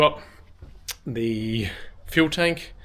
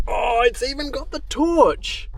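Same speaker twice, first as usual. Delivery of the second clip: excited; 12 semitones higher, 3 dB louder, slower than usual. Real, not acted. Delighted find in the glove box.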